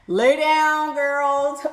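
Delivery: high, sustained "down"; shocked, stretched-out order; scandalized exclamation. Real, not acted. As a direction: call it out high, holding "down". Repeats it in louder shock.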